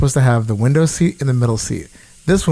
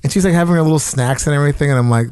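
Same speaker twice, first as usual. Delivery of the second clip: ordinary delivery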